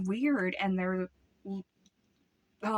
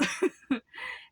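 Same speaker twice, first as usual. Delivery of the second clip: nervous laugh; 14 semitones higher than usual. Real, not acted. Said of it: "that scene gave me goosebumps".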